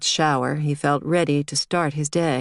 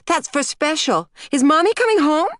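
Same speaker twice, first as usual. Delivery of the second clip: put-on very high child voice, rising to a louder question; object, then ask Daddy about Mommy; little girl; 12 semitones higher, 4 dB louder than usual. A narrator's reading. Small girl questions her father.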